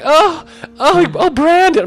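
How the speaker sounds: very high, mock-passionate moans; imitated moaning woman. Storyteller overhears her with Brandon, imitated high moaning. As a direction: moan upward in a hammed-up falsetto. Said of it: "I hear her be like".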